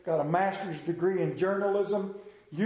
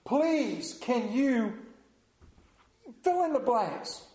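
high, pleading please; pleading asker; 6 semitones higher, slower than usual. Acted. Preacher voicing a needy person asking for help, pleading. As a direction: beg for help with a lifted voice.

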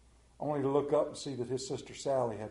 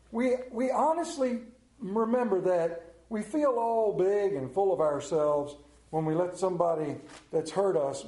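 ordinary talk, nothing marked

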